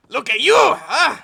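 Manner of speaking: put-on loud, high Mexican accent breaking into laughter. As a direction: taunt him again in an overdone Mexican accent. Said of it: "I can't do the accent".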